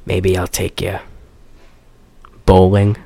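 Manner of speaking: low leading-man imitation; mock-smooth, starting soft and low; mock suave. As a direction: deliver a cheesy pickup line, deep and paused. Acting out film's absurd pickup line.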